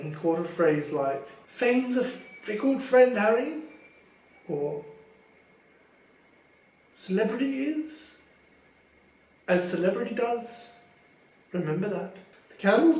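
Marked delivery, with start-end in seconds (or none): 1.5-3.6 pompous Lockhart. Acted. Vain teacher lecturing Harry on fame, pompous. 6.9-12.2 pompous Lockhart. Acted. Vain teacher lecturing Harry on fame, pompous.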